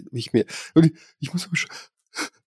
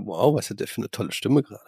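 lower, softer mock deep voice; deep manly voice; 4 semitones lower, 6 dB quieter, faster than usual. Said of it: "hört sie sich so sehr männlich und tief".

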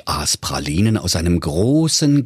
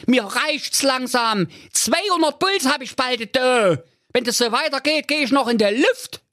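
fed-up talking bomb. A narrator's reading. Annoyed bomb complaining to digger driver, very high fed-up voice.

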